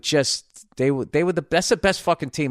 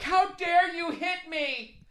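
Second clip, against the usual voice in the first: high, slow, hammed-up outrage; mock batter whining at the pitcher; mock indignant batter; 3 dB quieter, much slower than usual. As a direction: squeal it like an offended gladiator. Mocking a batter charging mound.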